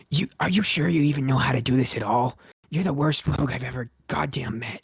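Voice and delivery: loud scolding goblin voice; a lightly gruff goblin chewing him out. Acted. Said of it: "a third gruffer voice".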